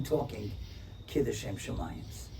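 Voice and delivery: preacher closing his point, hushed and slow; hushed close. Real, not acted. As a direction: drop to a reverent hush. Sermon climax fades.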